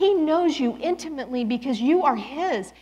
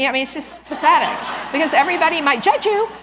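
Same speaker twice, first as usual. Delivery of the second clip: loud laughing exasperation; 3 semitones higher, 7 dB louder, faster than usual. Real, not acted. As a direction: laugh it off, louder.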